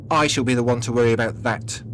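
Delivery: irritated, forceful interruption; snapping. A narrator's reading. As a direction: snap over the other speaker. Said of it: "he snaps, cutting her off".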